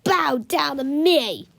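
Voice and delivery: commanding. A narrator's reading. Melvin commanding Captain Underpants, slow and loud. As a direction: lay the order down heavily. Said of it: "he commanded".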